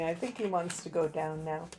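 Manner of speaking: usual speaking voice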